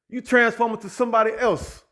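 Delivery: ordinary delivery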